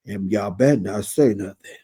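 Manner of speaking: low deep mock husband voice; impression of Dr. Damon. Retelling Damon backing Heavenly, in a low voice. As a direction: drop the voice down for the man. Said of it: "Doctor Damon".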